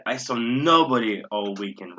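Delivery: "nobody" swelled and leaned on; stress on nobody. Shown as a demonstration. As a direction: punch the word "nobody". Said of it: "Again, I'm emphasizing this".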